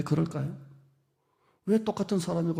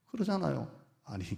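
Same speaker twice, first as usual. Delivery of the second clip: usual speaking voice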